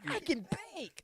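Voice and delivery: high, timid little voice; squeaky baker voice. Retold or imitated. Baker admits a secret hobby to jocks, high and timid. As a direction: pipe it up like a shy kid. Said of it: "voicing the baker".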